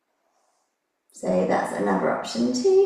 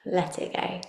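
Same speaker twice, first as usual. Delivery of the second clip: calm meditative tone; 7 dB quieter than usual. Acted. Leads a guided relaxation.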